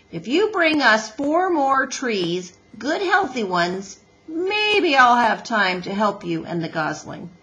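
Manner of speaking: loud, gruffly bargaining beaver; Mister Beaver. Acted.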